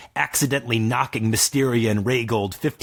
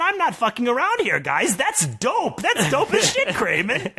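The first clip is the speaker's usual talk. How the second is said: high, laughing praise; excited laughing; 13 semitones higher than usual. Acted. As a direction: gush through laughter.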